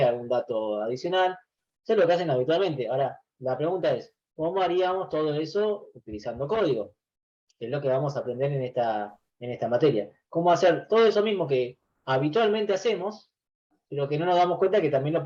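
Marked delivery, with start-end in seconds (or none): none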